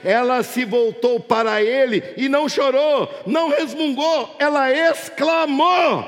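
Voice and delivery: swelling preacher's crescendo; building climax. Real, not acted. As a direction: start measured and climb to a ringing shout.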